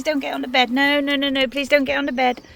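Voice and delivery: high, loud pleading no to the dog; loud high scolding of a muddy dog; telling off the dog. Real, not acted. Scolding dog jumping on bed.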